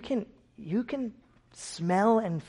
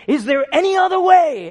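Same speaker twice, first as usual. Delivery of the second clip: loud, high anguished plea; desperate loud cry to the Father; 13 semitones higher, 14 dB louder than usual. Acted. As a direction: cry it out to heaven. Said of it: "he's crying out to the Father".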